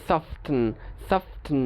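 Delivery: সফটেন with an audible t between f and n; T pronounced. Shown as a demonstration. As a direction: force the silent t into সফটেন. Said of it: "উচ্চারণ করতে কষ্ট হয়".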